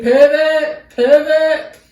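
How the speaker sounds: loud, high-pitched movie-quote yell; imitated scream. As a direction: scream it like Ross moving the couch. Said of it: "he keeps screaming".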